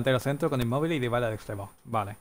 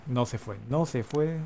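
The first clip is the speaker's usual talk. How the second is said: ordinary talk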